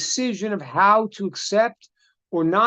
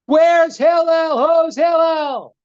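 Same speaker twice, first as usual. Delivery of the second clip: high, slow, loud calling out; mock street scream; 8 semitones higher, 5 dB louder, much slower than usual. Joker screaming for Hillel in the street, loud slow mock yell. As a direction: bellow the name across the street like a heckler. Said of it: "starts screaming in the middle of the street".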